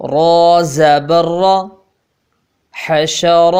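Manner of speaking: measured, drawn-out spelling recital; slow syllable drill. Shown as a demonstration. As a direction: spell each letter out slowly for pupils. (धीमा तहज्जी वाला पाठ)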